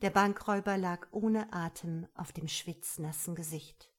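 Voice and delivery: plain reading voice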